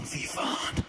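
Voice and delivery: a breathy whispered "Fy fan"; whispered curse. Retold or imitated. Old lady cursing her heavy bags, whispered. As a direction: mutter it under strain.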